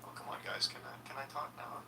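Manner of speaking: soft breathy whisper, fed up; whispered complaint. Retold or imitated. Kid ignored by classmates, whispering a complaint. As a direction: plead under your breath to be heard. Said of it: "like a kid at show and tell".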